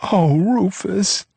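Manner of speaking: high, slow drawn-out wail. A narrator's reading. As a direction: wail his name. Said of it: "Leona wailed".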